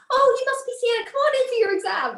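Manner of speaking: mock-bright high examiner voice; mimicking the examiner. Examiner greeting her as the pupil, high and chirpy.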